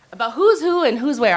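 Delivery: loud, peppy cheerleader shout. Shown as a demonstration. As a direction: belt it out like a cheerleader. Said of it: "there's my cheerleader voice".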